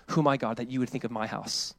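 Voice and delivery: soft, low, hushed prayer; humbled wonder. Retold or imitated. Preacher as David sitting before God, humbled and hushed. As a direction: let it sink down quietly in awe.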